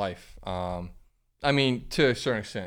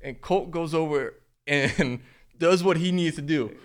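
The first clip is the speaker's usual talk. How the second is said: laughing while telling; 7 semitones higher, 4 dB louder than usual. Real, not acted. Laughing through a funny story.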